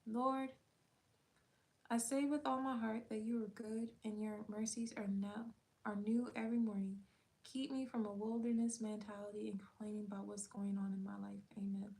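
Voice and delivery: soft, unhurried prayer read; quiet prayer. Acted. A final run through the prayer with viewers, soft and earnest. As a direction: pray it one last time, gently. One more time.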